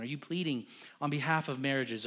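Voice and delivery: ordinary talk, nothing marked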